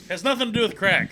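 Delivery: shouting it down, pitch up; loud, laughing denial; loud protest. Real, not acted. Friend shouting back in the crack argument, loud.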